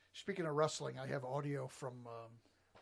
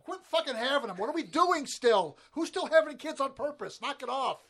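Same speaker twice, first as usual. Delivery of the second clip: loud, higher ranting scold; mock rant; 10 semitones higher, 10 dB louder than usual. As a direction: yell it like a fed-up rant.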